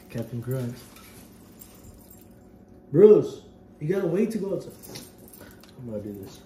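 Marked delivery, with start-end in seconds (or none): 2.9-4.7 loud, high call to the dog. Real, not acted. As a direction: call him back firmly. Calling to dog.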